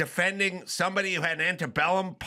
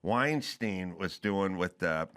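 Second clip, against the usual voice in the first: lower, slow, measured mock-reasonable voice; Matt Damon impression; 7 semitones lower than usual. Mocking the actor's reasonable tone, slow and lower. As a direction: play the careful pundit, slow and low. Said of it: "Matt Damon thing where he goes".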